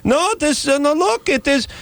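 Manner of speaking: very high-pitched mock Israeli woman; Israeli neighbor accent. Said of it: "sixty-year-old Israeli".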